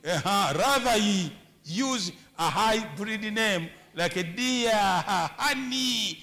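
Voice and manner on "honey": usual speaking voice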